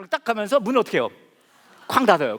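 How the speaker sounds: ordinary delivery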